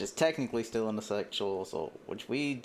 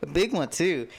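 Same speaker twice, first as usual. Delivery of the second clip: milder voice, only moderately raised; toned-down character voice; 6 semitones higher than usual. Acted. Redoing Tressa's line after giving up that voice, toned-down and lightly raised. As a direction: drop the squeal, keep a lightly lifted character voice. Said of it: "We won't give her that voice".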